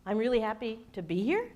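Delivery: quick statement ending in a question-like rise; doubtful uptalk rising on "here"; upward inflection. Shown as a demonstration. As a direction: lift "here" as if unsure. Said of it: "beware of upward inflection".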